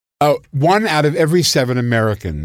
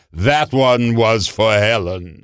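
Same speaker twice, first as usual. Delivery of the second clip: lightly grand diva; slower than usual. Retold or imitated. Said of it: "turns very grandly and says".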